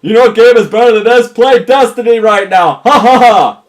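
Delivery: high, loud, over-clear shout; yelling. Pretend. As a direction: holler it like a pitch, with a shouted laugh. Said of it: "I have to yell".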